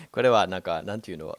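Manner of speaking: ordinary talk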